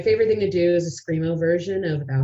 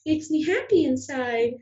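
higher, hopeful sales pitch; eager pitch; 7 semitones higher than usual. Retold or imitated. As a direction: earnestly suggest it in a raised voice. Pitching songs to past bookers.

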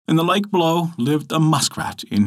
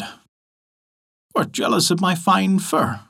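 slow, higher, sneering; sneering muskrat; 6 semitones higher, much slower than usual. Acted. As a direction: drag out the insult with contempt. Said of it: "sneered the muskrat".

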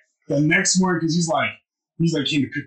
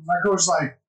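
usual speaking voice

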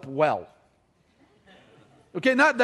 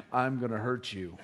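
low threat; 10 semitones lower, 9 dB quieter than usual. Retold or imitated. Threatening brother after prank.

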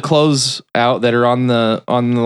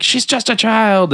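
high-pitched mock indignation; indignant; 11 semitones higher than usual. Father scolds the men about his daughter, mock indignant. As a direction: imitate an outraged protest. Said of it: "he's talking shit to these other guys".

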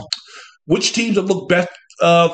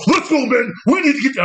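mock hype coach; 7 semitones higher than usual. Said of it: "coach, bring it up a notch".

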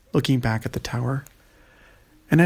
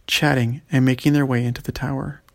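usual speaking voice

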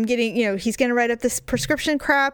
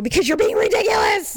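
high-pitched mock shout; retold yelling; 7 semitones higher than usual. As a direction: yell it back, hammed-up and exasperated. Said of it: "you're yelling and people are listening".

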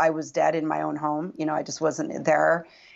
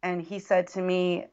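ordinary talk, nothing marked

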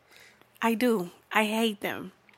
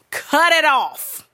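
loud, higher, drawn-out command; emphatic demand; 6 semitones higher, 6 dB louder, much slower than usual. Real, not acted. To lovey couples in public, emphatic demand. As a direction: stretch each word forcefully.

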